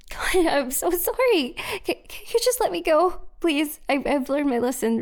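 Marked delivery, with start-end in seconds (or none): 0.1-3.8 desperate begging. Acted. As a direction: beg with the voice pitched up. Begging to be let go.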